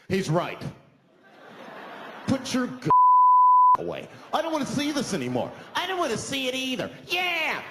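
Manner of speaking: high, loud pile-on of outraged passengers; suddenly brave bystanders. Acted. Dummies on the bus now speak up, brave indignation. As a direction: chime in loudly, emboldened. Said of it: "all these dummies on the bus, now they're brave".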